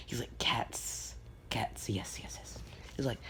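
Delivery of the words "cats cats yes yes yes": hushed, obsessive whisper fading softer; whispered craving. Retold or imitated. Aizawa hearing about the cat cafe, whispered craving.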